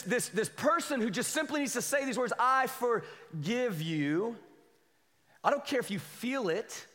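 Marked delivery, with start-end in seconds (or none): 2.4-4.4 drawn out. Shown as a demonstration. Preacher stretching out the words.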